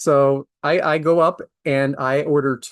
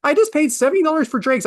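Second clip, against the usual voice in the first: exasperated outburst; 9 semitones higher than usual. Retold or imitated. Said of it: "I'm like".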